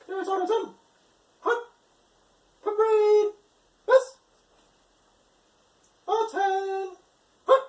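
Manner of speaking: high, loud drawn out drill calls; drawn out parade commands. Shown as a demonstration. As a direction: call it across the parade ground. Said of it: "I'll show you how that looks".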